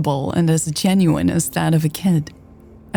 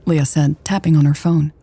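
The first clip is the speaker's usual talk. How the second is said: plain reading voice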